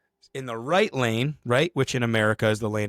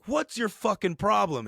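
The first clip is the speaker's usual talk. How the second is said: high-pitched indignant challenge; mock confrontation; 9 semitones higher than usual. Woman in charge confronting gate guy, high mock indignation. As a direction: imitate an angry woman demanding an answer.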